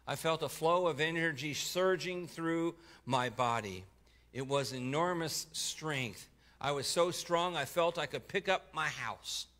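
rising, powerful narration; surging intensity. A narrator's reading. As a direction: swell with strength and awe. Surge of strength narrated.

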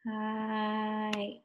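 "Hike" softly stretched out; slow. Shown as a demonstration. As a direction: sound out "Hike" slowly and softly. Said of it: "Let's say it slow".

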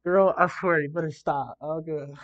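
loud and high, choked up by it; half-laughing plea to stop making her cry; overcome with feeling. Real, not acted. Host moved by recruit's words.

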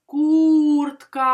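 loud, high, long У in Куртка; stressed vowel stretched. Shown as a demonstration. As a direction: hold the У in Куртка so it stands out. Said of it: "Який голосний ми краще чуємо?".

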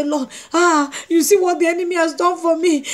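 high, plaintive wail; mock crying prayer. Believer complaining to the Lord about the enemy, mock crying. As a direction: imitate someone tearfully lamenting to God. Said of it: "start crying and say".

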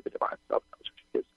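rapid, soft whisper; whispered channeling. Real, not acted. Medium relaying guides on divine self, whispered. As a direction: rush it out under the breath. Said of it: "I'm whispering the words".

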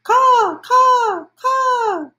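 high, loud cawing crow calls. Acted. Mother crow caws at Sal as she flies off, high cawing. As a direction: caw out like a crow taking flight.

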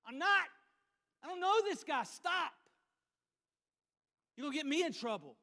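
high, loud, flustered no; panicked denial. Acted. Peter panics and denies knowing Jesus, loud and high. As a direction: wave off the accuser in a hurry.

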